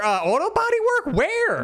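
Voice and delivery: can't believe the number, squeak it out; incredulous. Real, not acted. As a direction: pitch jumps way up in disbelief. Incredulous at fifty-dollar repair.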